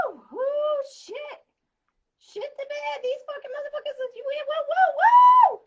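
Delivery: high, loud hyped whoops; excited whooping. Real, not acted. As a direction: cheer like the crowd went wild. Hyped cheering at the cards.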